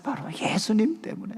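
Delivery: whispered, voiceless answer; God answers in a whisper; hushed voice of God. Retold or imitated. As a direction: breathe the answer like God speaking close. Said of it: "바로 주님이 그때 말씀해 주셨어요".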